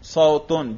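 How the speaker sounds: صوتن ending in -un; raf ending. Shown as a demonstration. Teacher models the raf for a student, صوتن ending in -un. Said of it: "رفع کا تلفظ کرو".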